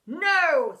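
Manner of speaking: loud, sharp refusal; snapping. A narrator's reading. Teacher refuses to free boy, snapping. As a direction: snap it with force. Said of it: "snapped Pent".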